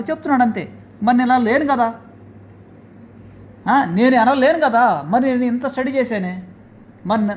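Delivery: ordinary talk, nothing marked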